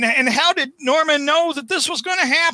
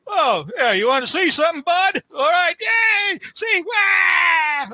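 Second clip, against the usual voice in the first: taunting Goblin voice; 7 semitones higher than usual. Retold or imitated. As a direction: crow it like a cocky Goblin. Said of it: "the Goblin says".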